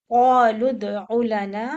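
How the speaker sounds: slow, loud tajweed recitation of क़ालू with qalqala on दाल; Quran recitation. Shown as a demonstration. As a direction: recite "क़ालू उदउ लना" slowly, bouncing the दाल. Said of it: "कलकला के साथ आएगा".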